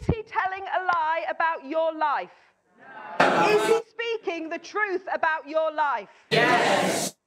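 shouted, slow and emphatic; big voice. Acted. Preacher leads the congregation in a big shout. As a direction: call it out loud to the whole room. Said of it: "let's say it in a big voice".

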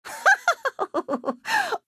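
high rippling laugh; amused cackle. Acted. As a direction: cackle at someone else's trouble. Amused at player's predicament.

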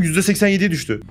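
normal talking voice

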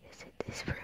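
creepy whisper; spooky whisper. Pretend. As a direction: hiss it like a horror movie.

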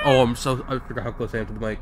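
ordinary talk, nothing marked

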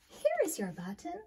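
lifted, eager call of a find; glad cry. Acted. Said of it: "cried Frog".

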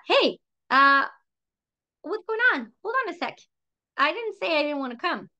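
high-pitched, acted puzzlement; taken-aback protest. Friend calling back after being let go, mock taken aback. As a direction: imitate a caller backpedalling in surprise.

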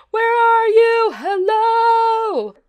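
loud, high, slow sing-song call. Pretend. Player searching for the ranger, calling loudly and high. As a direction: call across a wide park like a lost camper.

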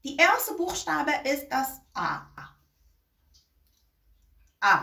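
slow German sentence, then the letter آ held open; teacher letter demo. Shown as a demonstration. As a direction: demonstrate the German A slowly. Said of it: "اینو ببینید".

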